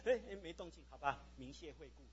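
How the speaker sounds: soft, mock-resigned sign-off; acted half-hearted farewell; giving up. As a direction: let the prayer fizzle out quietly. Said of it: "算了".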